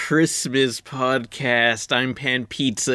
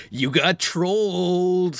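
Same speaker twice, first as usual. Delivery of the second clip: meme-quoting cartoon lizard; 5 semitones higher, much slower than usual. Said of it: "he'd be quoting, like, internet memes".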